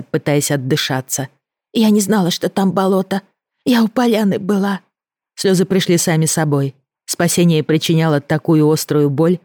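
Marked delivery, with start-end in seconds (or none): 1.7-4.8 breathless and scared. Acted. Леся оправдывается, задыхаясь.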